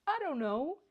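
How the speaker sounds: high, dipping I don't know; high-pitched, put-upon shrug of an answer; helpless reply. Retold or imitated. Answering the teacher, retold helplessly.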